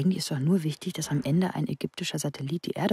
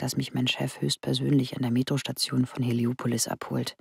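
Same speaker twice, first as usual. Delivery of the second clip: normal talking voice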